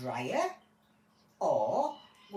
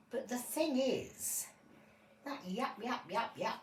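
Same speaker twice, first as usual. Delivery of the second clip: ordinary talk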